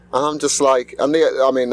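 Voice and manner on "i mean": ordinary delivery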